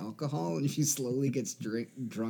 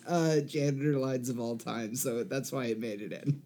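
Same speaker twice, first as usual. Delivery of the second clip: usual speaking voice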